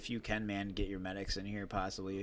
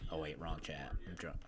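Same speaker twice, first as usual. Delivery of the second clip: whispering; 5 semitones lower, 9 dB quieter than usual. Real, not acted. Realizes he's on wrong voice chat.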